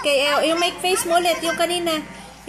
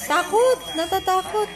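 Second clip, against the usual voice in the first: acting scared; 5 semitones higher than usual. Shown as a demonstration. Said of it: "Paano natatakot?".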